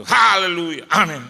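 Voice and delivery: high, loud closing shout; loud haleluya. Retold or imitated. Pastor closing the house prayer with a loud shout. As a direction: cap the prayer with a big cry.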